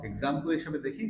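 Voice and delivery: usual speaking voice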